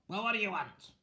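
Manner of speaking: high, demanding witch voice; demanding witches. Acted. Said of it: "they demanded all three".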